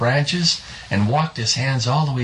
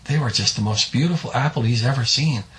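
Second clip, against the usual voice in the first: ordinary delivery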